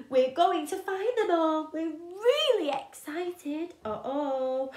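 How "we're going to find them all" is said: usual speaking voice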